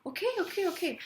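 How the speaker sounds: high, sing-song giving in; mock placating. Giving in to the boyfriend's nagging, high and placating. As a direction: brush him off sweetly.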